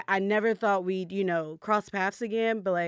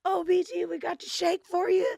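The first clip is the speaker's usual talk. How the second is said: mock staff call-out; 10 semitones higher than usual. Staff calling out to B G.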